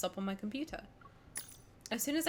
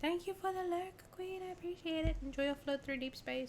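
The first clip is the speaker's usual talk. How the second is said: cutesy voice; 7 semitones higher, 8 dB quieter than usual. Acted. Cutesy thanks to viewer.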